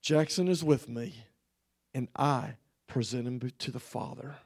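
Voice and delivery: the Lord's firm word, dropping low and soft; quiet authority. Retold or imitated. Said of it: "said it with a strength of authority".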